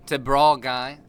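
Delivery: ordinary talk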